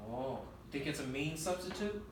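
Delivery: ordinary delivery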